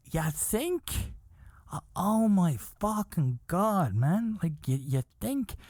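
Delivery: high-pitched, exasperated disbelief; incredulous sarcasm. Retold or imitated. Comic answering her understatement, sarcastically incredulous. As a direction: lift it high, as if she said something absurd.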